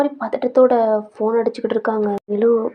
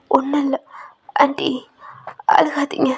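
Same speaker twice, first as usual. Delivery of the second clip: tearful whispered plea; whispered comfort; 2 semitones higher than usual. Acted. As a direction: hush her gently, voice catching. Comforting crying aunt.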